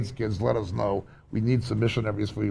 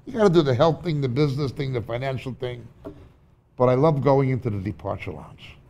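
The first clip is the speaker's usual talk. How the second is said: normal talking voice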